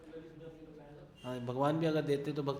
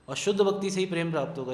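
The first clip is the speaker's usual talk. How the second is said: "शुद्ध भक्ति" driven home loud, fast and higher; emphatic point; 5 semitones higher, 9 dB louder, faster than usual. Real, not acted. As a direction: hammer the line home so they remember it. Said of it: "इस चीज़ को याद रखिएगा".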